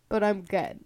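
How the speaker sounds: usual speaking voice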